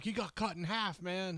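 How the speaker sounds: high-pitched mock panic; hammed-up freak-out, voice jumping high. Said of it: "the guy was freaking out".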